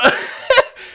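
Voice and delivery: loud, very high giggle; high squealing laugh. Real, not acted. Cracking up at chat's reaction.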